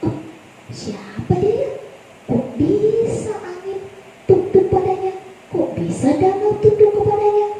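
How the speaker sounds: high, hushed astonishment; amazed wonder. Acted. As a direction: marvel under your breath. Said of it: "Lalu mereka terheran".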